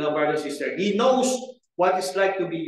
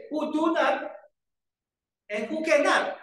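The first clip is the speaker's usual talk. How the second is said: "do" and "cannot" lifted higher; stress on the double negative; 6 semitones higher than usual. Shown as a demonstration. Preacher to the congregation, stressing "do" and "cannot". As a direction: lean on "Who do not" and "who cannot". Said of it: "That's a double negative".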